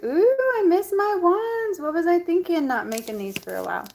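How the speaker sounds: high delighted gasp, slowed down; excited squeal. Retold or imitated. Rummaging through her crystals, squealing with delight. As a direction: coo over the wands like a long-lost treat. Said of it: "and I'm like".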